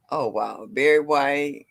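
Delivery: ordinary talk, nothing marked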